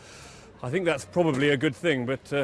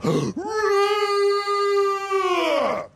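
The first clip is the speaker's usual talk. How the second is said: huge high held roar; Tiny's loudest roar to save the monster; 4 dB louder than usual. A narrator's reading. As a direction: roar with everything. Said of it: "roared his loudest roar".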